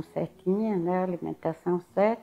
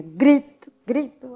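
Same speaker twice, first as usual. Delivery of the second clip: usual speaking voice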